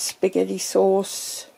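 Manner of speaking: usual speaking voice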